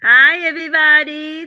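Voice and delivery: loud, slow, stretched-out announcement; excited shout. Real, not acted. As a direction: call it out to open the show. Opening a live podcast.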